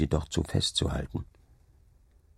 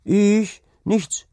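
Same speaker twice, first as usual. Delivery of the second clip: high, loud startled reply; startled boy; 6 dB louder than usual. A narrator's reading. Servant boy answers the farmhand's challenge, startled high voice. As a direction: pipe up high, taken by surprise.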